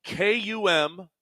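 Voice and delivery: "K U M" spelled letter by letter, slowly. Shown as a demonstration. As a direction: spell "K U M" deliberately. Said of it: "how would you spell come and go".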